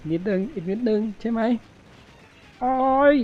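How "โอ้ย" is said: high, loud cry of pain; pained yelp. Real, not acted. Gamer cries out when the boss hits him, loud yelp. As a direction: yelp as you get hit.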